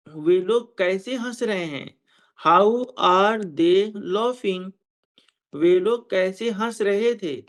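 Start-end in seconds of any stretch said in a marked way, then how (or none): 2.5-4.7 very slow, drawn-out teacher's example; slow model sentence. Shown as a demonstration. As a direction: stretch each word for learners. Teacher models translation slowly. (बहुत धीरे, एक-एक शब्द)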